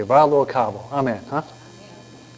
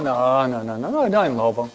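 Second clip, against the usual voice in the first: lower, soft string of denials; niño negándolo; 4 semitones lower, 4 dB quieter than usual. Retold or imitated. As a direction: wave it away softly as the boy. Boy admits there is none. (negación baja y suave)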